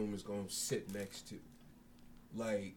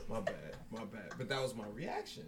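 ordinary talk, nothing marked

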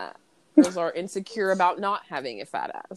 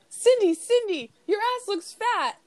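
high, slow, sneering call, much higher; mock taunt; 12 semitones higher than usual. Reporter calling out to Cindy to provoke her, high sneering taunt. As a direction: bait her with a sing-song jab.

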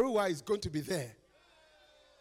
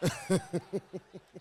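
laugh; 3 semitones lower than usual. Real, not acted. Laughing at joke.

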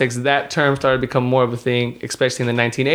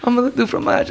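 high squeaky cartoon French; French accent; 11 semitones higher than usual. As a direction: imitate Dexter's French phrase. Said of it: "shout out to Dexter's Lab".

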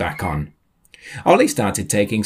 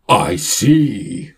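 delicate bellow; 5 dB louder than usual. Acted. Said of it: "bellowed the lady in a surprisingly delicate manner".

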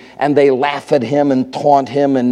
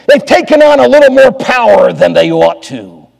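loud, higher-pitched pulpit swell; indignant preacher's emphasis; forceful preaching; 7 semitones higher, 8 dB louder than usual. Real, not acted. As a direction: lift the voice and press the point home. Preacher swells on establishment's power.

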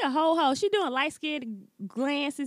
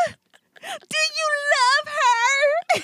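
mock crying; 14 semitones higher, 4 dB louder, slower than usual. Acted scene, high tearful voice.

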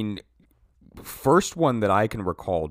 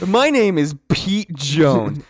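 mock announcer; 5 semitones higher, 6 dB louder, much slower than usual. Mocking slow intro.

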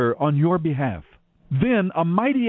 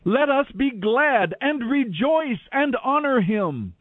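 huge crowd's shout; 6 semitones higher than usual. A narrator's reading. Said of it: "what sounded like the shout of a huge crowd".

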